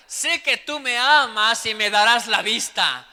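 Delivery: loud, slow desperate plea. Retold or imitated. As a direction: shout it like a blind beggar begging for mercy. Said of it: "Más gritaban". (grito desesperado y lento)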